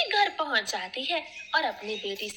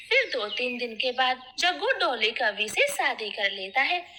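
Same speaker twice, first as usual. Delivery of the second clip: ordinary delivery, nothing marked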